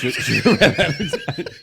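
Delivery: slow, laughing name; real laughter over a name; laughing repeat. Real, not acted. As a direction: drag the name out through laughter.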